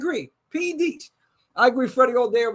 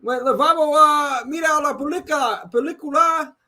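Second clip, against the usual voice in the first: put-on Dominican accent; 6 semitones higher than usual. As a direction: fake Dominican Spanish. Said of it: "more Dominican than Italian".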